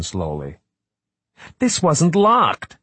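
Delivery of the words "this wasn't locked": sharp, high and slow. Acted. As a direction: cut in sharply, alarmed. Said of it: "she said sharply".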